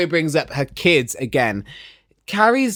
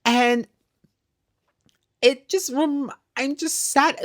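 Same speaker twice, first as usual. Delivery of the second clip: imitated tearful voice, high and slow; Tiffany; 10 semitones higher, slower than usual. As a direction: put on a high, weepy lament. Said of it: "'Cause Tiffany's in tears".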